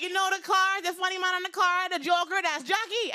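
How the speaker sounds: put-on high Jamaican-accented explaining; Jamaican mother. Mom explaining to neighbors, in a high Jamaican accent. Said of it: "her very heavy Jamaican accent".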